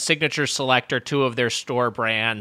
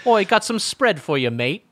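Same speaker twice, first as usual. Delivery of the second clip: higher, cheeky Cockney "oi"; mock British accent; 4 semitones higher than usual. Co-host keeps mocking Brits, cheeky British accent. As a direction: lay the British on thick, as a joke.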